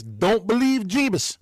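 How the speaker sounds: high, loud and slow mock warning; mock quote. Mocking the idea that Masons preach against Jesus, slow and high. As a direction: sneer out the silly rule. Mocking an imagined forbidden teaching.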